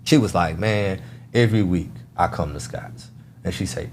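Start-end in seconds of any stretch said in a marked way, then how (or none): 0.6-3.1 low, soft mock disgust. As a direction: imitate her fed-up tone, low and quiet. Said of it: "she kinda disgusted".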